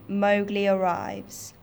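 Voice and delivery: ordinary delivery, nothing marked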